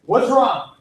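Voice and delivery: ordinary talk, nothing marked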